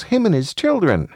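put-on high-pitched small boy; high child voice; little child. A narrator's reading. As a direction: say it like a young child. Child voiced, father echoes it.

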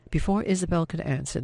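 ordinary delivery, nothing marked

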